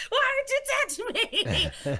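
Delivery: very high, panicky wail; panicked shriek. Retold or imitated. Man panicking at Jesus's touch, squealing high. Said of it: "panicking and shrieking".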